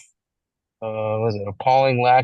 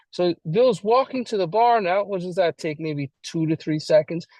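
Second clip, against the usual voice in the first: ordinary talk, nothing marked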